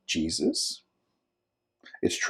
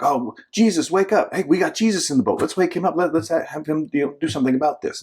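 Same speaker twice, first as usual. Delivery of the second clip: higher-pitched, hurried mock panic; panicky disciples; 7 semitones higher than usual. Disciples waking Jesus in the storm, mock panicked. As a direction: act out scared men pleading for help.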